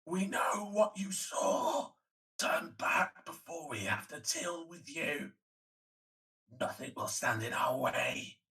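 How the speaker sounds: slow, drawn-out ghostly warning. Acted. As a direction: intone it like a spirit from beyond. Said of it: "ghostly voice again saying".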